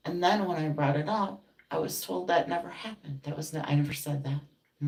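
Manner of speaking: high, loud indignant complaint; real outrage. Real, not acted. Venting about a friend who denied everything, indignant. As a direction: burst out with the injustice of it.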